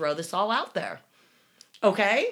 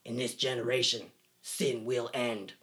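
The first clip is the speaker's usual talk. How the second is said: solemn prophet; 6 semitones lower, 3 dB quieter than usual. Retold or imitated. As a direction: intone it like a prophet. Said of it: "quote from Little Man".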